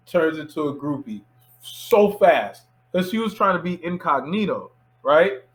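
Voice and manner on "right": usual speaking voice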